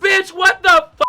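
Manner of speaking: high, loud sassy shout; sassy drag queen. Real, not acted. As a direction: diva disbelief yell. Mirror complete.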